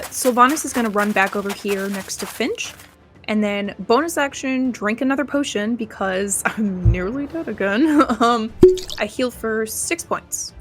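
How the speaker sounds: normal talking voice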